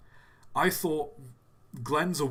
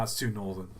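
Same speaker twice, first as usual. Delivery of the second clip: ordinary talk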